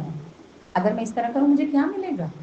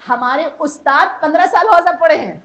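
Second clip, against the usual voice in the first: louder, rising sermon climax; preaching at full force; 6 semitones higher, 10 dB louder than usual. Acted. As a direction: drive the point home to the gathering.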